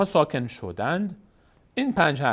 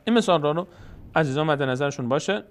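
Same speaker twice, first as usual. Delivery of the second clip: ordinary talk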